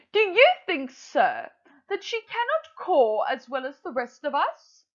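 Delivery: high, eager, pushing mother crow; impatient Madam Crow. Acted. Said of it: "I'm going to put on a voice".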